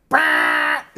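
low bleating goat noise; deep imitated goat bah. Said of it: "a goat appears next to him and just goes like".

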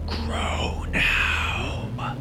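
eerie high voiceless whisper; raspy whisper. A narrator's reading. Unseen voice whispering at Logan in the alley. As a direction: hiss it like a ghost from nowhere. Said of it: "the raspy whispers".

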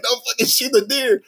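high mock plea; imagining stopping the hunters, high mock plea; pleading. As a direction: beg them not to shoot, high.

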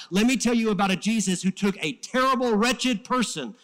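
loud, high-pitched declaration of faith; impassioned preaching. Real, not acted. Preacher proclaiming Jesus to the congregation, impassioned. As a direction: preach it at full force.

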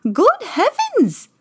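very high, slow shocked exclamation; startled fairy godmother. Acted. Godmother sees the ruined dress, high and shocked. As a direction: gasp it out in dismay.

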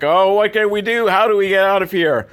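high-pitched mock lament; mock bemoaning stooges. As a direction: whine like helpless tied-up stooges. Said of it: "bemoaning their fate".

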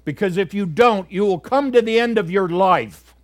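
loud, high, urgent pulpit exhortation; preaching at full force. Acted. Preacher urging young people to trust God, loud and fervent. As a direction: thunder it out to the young congregation.

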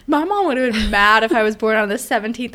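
normal talking voice

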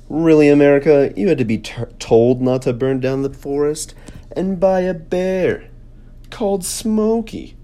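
mock the old video; mocking younger self. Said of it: "he says".

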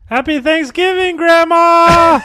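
high, loud gleeful yell; gleeful shout. Pretend. Cohost adding a ghoulish cheer to the threat, yelling. Mock horror greeting.

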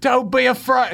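high, loud mock British voice; British accent. Friend echoing the movie cat impression, mock British accent. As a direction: put on a high, Harry Potter-style British accent. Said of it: "It's like Harry Potter".

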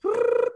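high motor-mouth trill; mock speed-talk blur; imitated rapid-fire babble. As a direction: mimic your own too-fast talking as a noise. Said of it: "'cause I'm like".

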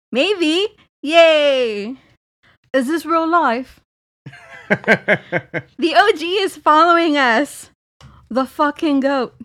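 loud, high, overexcited fangirl reading; own texting voice, hammed up. Reading own texts about a podcast idol, gushing. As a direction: blow the excitement up theatrically. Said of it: "in my best [name] voice".

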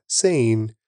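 ordinary delivery

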